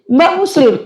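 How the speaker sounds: high, incredulous question; retold astonishment, high-pitched; shocked. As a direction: imitate a stunned greeter, pitched well up. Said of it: "Kaget, Pak".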